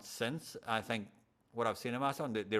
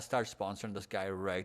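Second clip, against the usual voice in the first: normal talking voice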